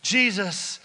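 usual speaking voice

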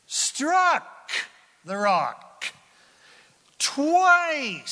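struck and twice thrown up high and loud; dramatic emphasis. A narrator's reading. Dramatic climax of story.

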